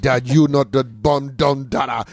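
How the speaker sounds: hammed-up Jamaican patois boasting; mock Jamaican accent. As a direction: put on a bad Jamaican accent. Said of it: "starts talking in a Jamaican accent".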